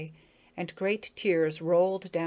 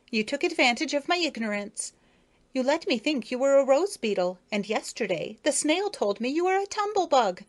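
high, haughty lady beetle; primly scolding, looking down on him; Effie's voice; 6 semitones higher, 3 dB louder than usual. Acted. Effie haughtily breaking engagement.

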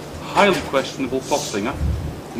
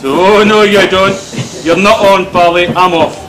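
mock loud refusal; 4 semitones higher, 7 dB louder than usual. Father voices daughter fleeing the dentist's pliers, mock loud protest. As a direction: loudly imitate a balking little patient.

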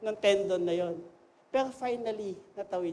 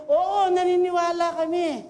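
louder and higher, full of conviction; ringing, certain yes; confident crowd reply; 6 semitones higher, 6 dB louder than usual. Retold or imitated. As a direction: answer as a crowd that truly believes. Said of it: "May conviction na sila".

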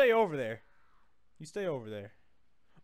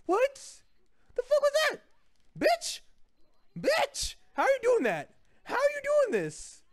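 very high squealed disbelief; high-pitched gamer outrage; incredulous outrage; 14 semitones higher than usual. Real, not acted. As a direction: squeal indignantly at an unfair move. Outraged at opponent's move.

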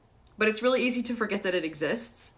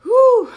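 loud whew; 15 dB louder than usual. Real, not acted. Loud exhale after a pause.

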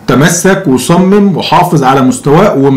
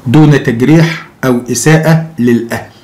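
usual speaking voice

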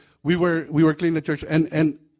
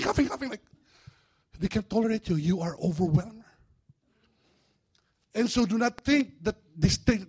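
usual speaking voice

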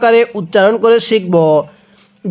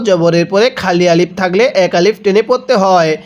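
ordinary talk, nothing marked

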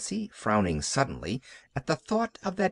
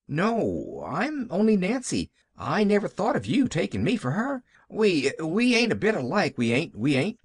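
put-on higher-pitched, agitated hired girl; flustered Nancy; 6 semitones higher than usual. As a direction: protest in a fluster, repeating yourself. Flustered Nancy corrects the child.

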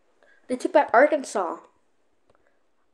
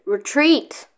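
shouted order; 4 semitones higher, 7 dB louder than usual. Real, not acted. Calling retreat to army.

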